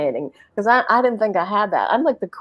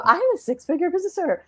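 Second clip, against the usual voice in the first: higher, fast mock brag; mock proud boast; 11 semitones higher, faster than usual. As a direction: rattle off the proud title. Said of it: "you talked about the label".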